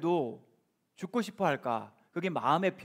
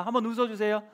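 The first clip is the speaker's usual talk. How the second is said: ordinary delivery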